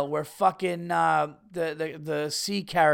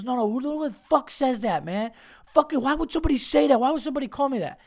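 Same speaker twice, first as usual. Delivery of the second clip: much higher, fast, hammed-up offended stranger; angry hothead; 7 semitones higher, faster than usual. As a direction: act like the stranger who won't let it go. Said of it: "He goes".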